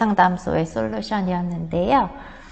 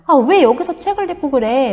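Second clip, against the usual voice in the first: a higher, louder mock snap from a younger brother; mock annoyed retort; 6 semitones higher, 6 dB louder than usual. Younger brother snapping back at his reading sibling, mock annoyed. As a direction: play the irritated kid talking back. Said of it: "뭐 이런 식으로".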